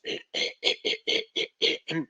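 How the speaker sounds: unvoiced throaty rasp from the back; guttural grunt. Shown as a demonstration. Lecturer demonstrating a guttural tic, throaty rasp. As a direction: force a rough guttural sound. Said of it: "sonidos guturales".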